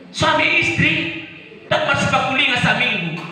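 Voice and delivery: fiery shouted preaching; preaching at full force. Acted. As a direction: cry out "Banyak orang Kristen" to the whole church.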